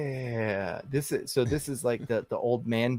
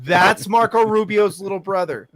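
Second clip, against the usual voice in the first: excited; 6 semitones higher, 10 dB louder than usual. Real, not acted. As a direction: announce the lookalike excitedly. Joking about lookalike on screen.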